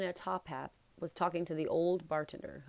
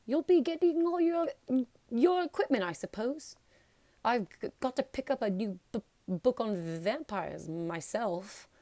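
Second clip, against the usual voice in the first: nervous Quirrell; 6 semitones higher, 5 dB louder than usual. A narrator's reading. Quirrell stammers on.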